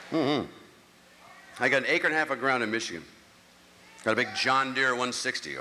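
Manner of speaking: ordinary talk, nothing marked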